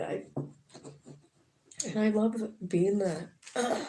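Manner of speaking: ordinary delivery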